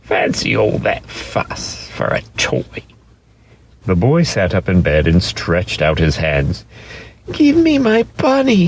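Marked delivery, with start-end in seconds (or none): 0.0-2.6 hushed, mild scolding nanny; lightly voiced nurse, under her breath; soft mock exasperation; Nana grumbling. A narrator's reading. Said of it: "she said". 7.3-8.7 put-on much higher child's voice, pleading; a small boy asking for his bunny from bed; high childlike plea; little boy. A narrator's reading. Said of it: "he said".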